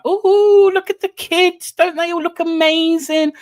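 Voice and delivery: high, gushing festive parent; mock the cooing parents at the play; jolly parent voice. Mocking the gushing parents at the nativity, high and cooing.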